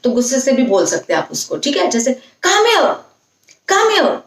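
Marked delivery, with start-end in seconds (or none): 2.5-4.2 higher, sharp scolding call; cross mother calling a stubborn child; angrily. Shown as a demonstration. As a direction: demand the child come now. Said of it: "अगर गुस्से से बोलना है".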